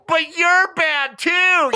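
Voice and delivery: slow, high, drawn-out accusing lady voice; high whiny scold. Retold or imitated. Ladies accusing the hero, high and slow. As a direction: stretch it out like a petulant accusation.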